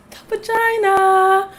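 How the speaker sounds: high, drawn-out singsong reveal; playful reveal. Real, not acted. Host unveiling tonight's topic to viewers, playful. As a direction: stretch it out high, like a teasing announcement.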